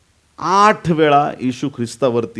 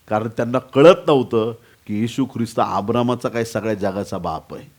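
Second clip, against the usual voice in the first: normal talking voice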